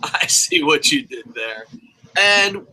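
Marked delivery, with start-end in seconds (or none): 0.0-1.7 knowing tease. Retold or imitated. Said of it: "I'm like".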